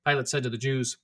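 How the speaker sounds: usual speaking voice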